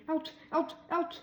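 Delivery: high, sharp ouches; pained yelps. A narrator's reading. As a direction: hop and yelp from the burn.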